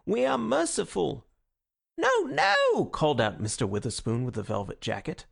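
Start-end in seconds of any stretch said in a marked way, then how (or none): 2.0-2.8 high, loud heckler's shout; shrill-voiced interrupting heckler; heckling cry. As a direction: cry out in protest from the crowd. Witherspoon heckling the meeting.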